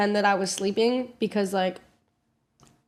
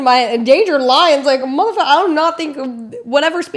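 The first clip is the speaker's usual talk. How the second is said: mock outrage; 5 semitones higher, 8 dB louder than usual. Inner disbelief, loud.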